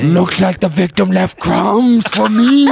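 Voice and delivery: slow, rising puppet-monster voice; hammed-up Muppet crime-scene voice; Cookie Monster. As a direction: draw it out like a gleeful monster detective.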